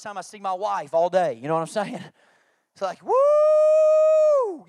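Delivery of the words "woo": very high, loud whoop of joy; excited whoop. Retold or imitated. Husband whooping to his wife that the kids are in bed. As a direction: cheer like the night is finally yours.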